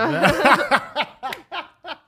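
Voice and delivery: loud high laugh; high burst of laughter. Real, not acted. Laughs at friend's craving.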